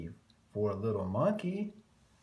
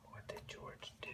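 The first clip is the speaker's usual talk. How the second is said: whispered question; 18 dB quieter than usual. Acted. Asking the listening kids.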